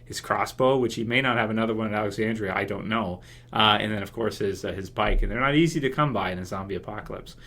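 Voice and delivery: ordinary talk, nothing marked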